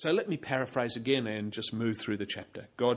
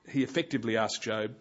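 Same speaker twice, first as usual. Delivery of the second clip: usual speaking voice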